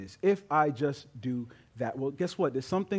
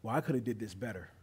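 grumble; 5 semitones lower, 7 dB quieter, faster than usual. Retold or imitated. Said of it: "you begin to grumble".

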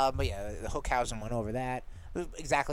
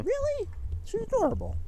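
much higher, indignant "Really?"; surprised high. Real, not acted. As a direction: leap up in pitch, disbelieving. Defending a beloved character.

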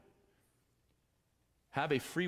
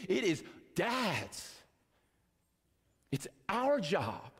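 high, fervent preacher's call, well above his usual voice; preaching at full force; 8 semitones higher than usual. Acted. Preacher urging the fathers to hold the line, fervent high preaching. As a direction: rouse the dads like a revival preacher.

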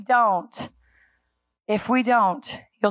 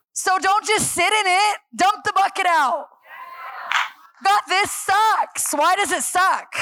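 overcompensated preaching; 5 semitones higher than usual. Real, not acted. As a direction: push it out to the crowd, too hard. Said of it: "I just overcompensated that whole thing".

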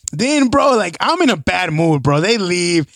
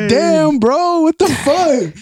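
high exclaim; 10 semitones higher, much slower than usual. Retold or imitated. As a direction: shout it up high, shocked. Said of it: "I was like".

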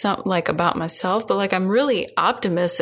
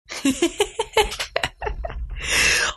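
breathy laugh; 12 semitones higher than usual. Real, not acted. Laughs after touche.

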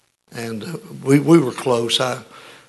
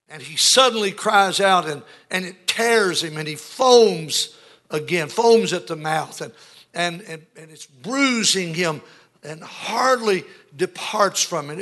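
high, emphatic pulpit retelling; preaching at full force; 4 semitones higher, 4 dB louder than usual. Acted. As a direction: preach it out, stretching the key words.

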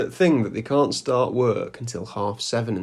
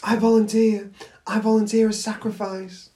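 mock volunteer; 9 semitones higher than usual. Mock dramatic volunteering for hamster.

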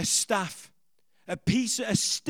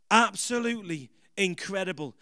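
ordinary delivery

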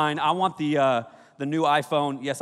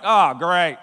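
louder dry sarcasm; sarcastic; 7 dB louder than usual. Retold or imitated. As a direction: say it like the worst luck. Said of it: "I was like".

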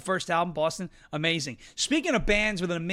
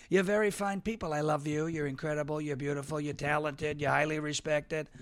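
softer, lower, cooing compliments; mock tender flattery; 5 semitones lower, 7 dB quieter than usual. Host mock-sweetly flatters his live chat audience, soft and cooing. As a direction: lay the flattery on gently.

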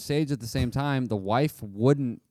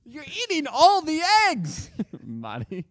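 put-on high, slow scolding old lady; shrill mock mother-in-law; 3 dB louder, slower than usual. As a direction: squeal it like an outraged mom. Mother-in-law scolding over eggs.

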